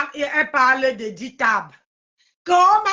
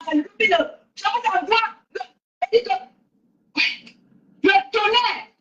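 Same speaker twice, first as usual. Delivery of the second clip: malédiction déclamée; 6 semitones higher, slower than usual. Acted. Malédiction théâtrale.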